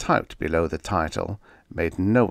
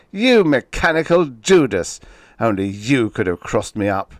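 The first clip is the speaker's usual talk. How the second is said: furious accusation; 3 semitones higher, 6 dB louder than usual. Acted. Enraged writer cursing his typewriter. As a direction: curse the betrayer.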